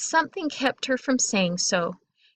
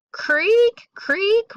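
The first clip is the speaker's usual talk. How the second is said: two high squeaky creaks; loud rising creaks; creaking landing; 11 semitones higher, 4 dB louder than usual. A narrator's reading. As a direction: imitate floorboards, each word pitched up and stretched. Voicing creaking landing in story.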